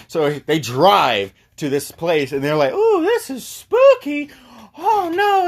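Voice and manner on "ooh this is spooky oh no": drawn-out, very high falsetto wail; hammed-up falsetto fright; mock spooked. Friends arrive at the spooky place, mock spooked.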